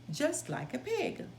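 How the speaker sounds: usual speaking voice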